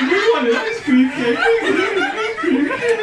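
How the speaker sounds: Ed sobbing to the cop, hammed-up blubbering; mock crying. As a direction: whine it out through fake tears. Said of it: "through his tears he goes".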